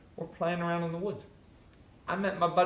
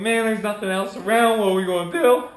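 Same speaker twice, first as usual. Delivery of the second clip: loud, higher-pitched worried protest; worked-up, raised-voice panic; retold freak-out; 6 semitones higher, 10 dB louder than usual. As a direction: plead loudly with your pals. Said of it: "I'm freaking out".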